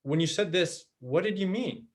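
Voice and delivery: open, collaborative question rising at the end; rising intonation. Shown as a demonstration. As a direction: lift "mean" at the end of the question. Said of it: "the intonation goes up".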